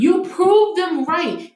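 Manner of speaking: slow and high, leaning hard on proved; heated repeat. Real, not acted. Repeating it over the co-host, heated and deliberate.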